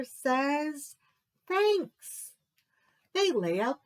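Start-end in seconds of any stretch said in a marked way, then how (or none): 1.5-2.4 high thankful Bear; grateful bear voice. Acted. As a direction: say Thanks gladly. Bear's grateful reply.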